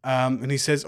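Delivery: ordinary talk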